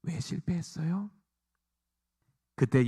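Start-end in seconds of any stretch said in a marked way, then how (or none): 0.0-1.1 slow, much softer rising question; hushed question. Acted. Disciples privately asking Jesus why, hushed. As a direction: ask it again under your breath. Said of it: "조용히 가서 물어봅니다".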